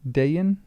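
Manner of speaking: Dayan in two syllables, DAY-an, questioning; long-A pronunciation. Shown as a demonstration. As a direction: try out "Dayan" with a long A, rising. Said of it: "'Cause the accent's on the A".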